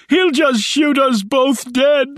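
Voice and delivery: very high, drawn-out panicked yell. A narrator's reading. Wounded Rice warning Shaw of the shooter, yelling. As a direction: stretch out a desperate high-pitched cry. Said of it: "yelled Rice".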